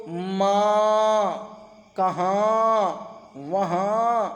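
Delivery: ماں، کہاں، وہاں with the final noon fully through the nose; nasal noon demo. Shown as a demonstration. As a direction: draw out ماں and sound the ending only as nasal hum. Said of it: "وہ نون جو ناک سے پڑھا جائے". (ناک سے پڑھا گیا نون غنہ)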